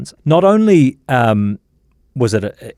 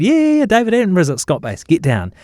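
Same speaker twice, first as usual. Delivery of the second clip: high-pitched retold excitement; excited pilot; 8 semitones higher than usual. Pilot tells the crew who has turned up, mock-excited. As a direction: voice an excited pilot breaking big news, pitched up. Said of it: "the pilot gets a bit excited".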